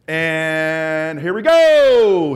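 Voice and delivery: slow, stretched and loud; drawn-out start call. Real, not acted. Coach launching the last set for the class, slow and loud. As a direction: drag it out to build the moment.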